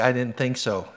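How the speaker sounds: usual speaking voice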